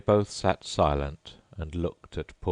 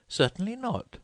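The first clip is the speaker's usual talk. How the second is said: put-on much higher indignant girl. A narrator's reading. Alice indignant about washing lessons, high pitched. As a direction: bristle at the suggestion. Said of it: "said Alice indignantly".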